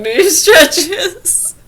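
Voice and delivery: put-on very slow, high and loud laughing old-man line; laughing quote. Retelling the old man's line, laughing, high and loud. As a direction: laugh it out while stretching each word.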